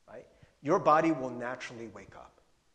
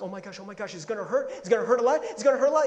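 mock panic, higher and louder as it builds; freaking out; 5 semitones higher, 9 dB louder, faster than usual. Preacher retells his broken-wrist panic, acted freaking out. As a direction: re-enact a rapid, rising fret. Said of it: "I'm freaking out".

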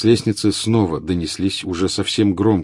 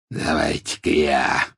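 growled; 3 semitones lower, slower than usual. A narrator's reading. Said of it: "прорычал Сивый".

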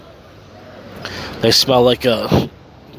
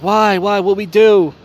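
higher, louder mock protest; lightly hammed-up whiny protest; indignant protest; 8 semitones higher, 5 dB louder, slower than usual. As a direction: imitate them whining why, pitched up. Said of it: "And Roger and Linda were like".

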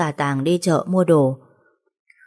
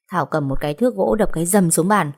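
plain reading voice

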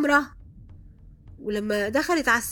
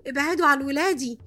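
raised-voice warning, first cry; retold yell, moderately loud and higher; 4 semitones higher, 4 dB louder than usual. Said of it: "قعدت أزعق في الصالة كلها". (زعيق محكي بصوت مرفوع)

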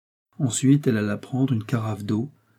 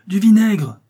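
high, loud urgent shout; crying out; 10 semitones higher, 9 dB louder, slower than usual. A narrator's reading. Charles shouts for vinegar to revive fainting Justin. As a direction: bark the order in a panic. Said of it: "cria-t-il".

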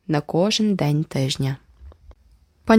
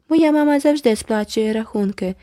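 drawled, higher mocking echo; drawn-out mimicry; 4 semitones higher than usual. A narrator's reading. Girl drawling a rival's boast back at her. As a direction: stretch the words out to mock. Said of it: "протяжно повторила Джеральдіна".

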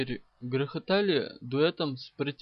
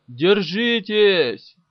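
long, drawn-out warning shout; stretched-out bellow to the passengers; yelling; 4 semitones higher, 12 dB louder, much slower than usual. A narrator's reading. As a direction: holler it high, loud and slow. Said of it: "вопил Каблуков".

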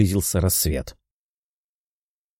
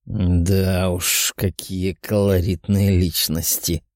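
demon mutter; 2 semitones lower, slower than usual. A narrator's reading. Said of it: "пробормотал демон".

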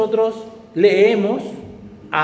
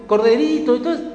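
high whiny mock grumble; mocking complainer; 8 semitones higher than usual. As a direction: mimic a nostalgic griper. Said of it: "Y algunos dicen".

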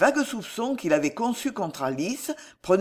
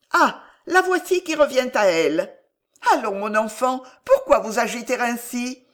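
put-on high, louder old woman's voice; fussing old aunt; 6 semitones higher, 4 dB louder than usual. A narrator's reading. Old aunt questions her reviving niece, high fussing voice. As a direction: coax her with put-on solicitude.

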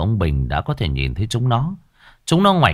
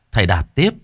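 ordinary delivery

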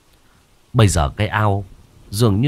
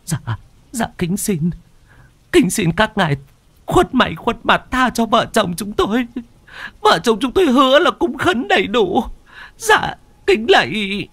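high, trembling, stammered begging; stammering plea; 12 semitones higher than usual. A narrator's reading. Terrified old woman begging ghosts to spare her, high and stammering. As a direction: beg the spirits for mercy, stumbling over words. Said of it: "bà Đục chỉ có thể chắp tay mà lắp bắp".

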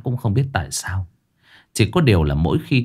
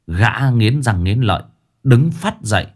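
usual speaking voice

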